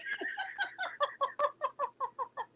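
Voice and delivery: helpless high-pitched giggle; high laugh. Real, not acted. Mother giggling while telling the robbery story. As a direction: burst into a squeaky laugh.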